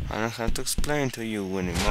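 normal talking voice

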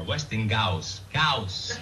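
Westinghouse said slowly as "Westing-gauss", then Gauss alone; insistent mispronunciation. Acted. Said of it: "It's not Westinghouse".

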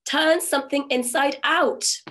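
high, loud, slow call. Acted. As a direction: shout it across the bog. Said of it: "she called out to him".

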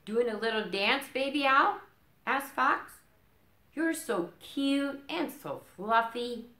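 high-pitched doting fox; sweet Fox voice. Acted. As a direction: coo at the owl like a sly fox. Fox voiced in read-aloud.

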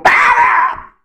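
high, loud desperate shout; shouting stop. Acted. Rider at the cliff screaming at the camel.

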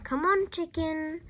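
high-voiced hen call; coaxing. A narrator's reading. George beckons the hen, coaxing. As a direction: lure the bird closer.

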